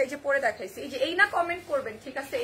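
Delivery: ordinary delivery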